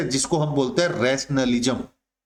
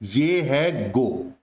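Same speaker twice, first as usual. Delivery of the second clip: slow emphasis; 3 semitones lower, much slower than usual. Shown as a demonstration. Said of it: "last word की जो pronunciation है".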